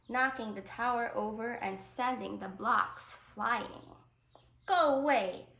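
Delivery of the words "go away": louder, higher angry cry; boy shouting at his grandfather in anger; child's angry shout. A narrator's reading. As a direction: yell it at grandfather. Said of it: "An shouted".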